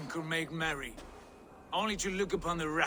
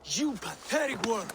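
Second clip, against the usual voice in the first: contemptuous snarl; 5 semitones higher than usual. Acted. Son insults father in rage.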